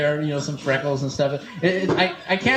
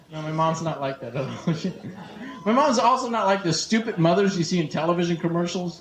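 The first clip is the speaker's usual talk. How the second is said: ordinary talk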